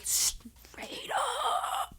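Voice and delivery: breathy whisper with a catch, like near tears; whispered disbelief. Real, not acted. Telling viewers how the insult landed, stunned whisper. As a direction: hush it, stunned.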